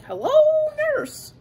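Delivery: squeaky high cartoon swoon on nurse; Animaniacs catchphrase. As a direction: leap way up for a cartoon wolf-whistle hello. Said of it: "Remember that from Animaniacs?".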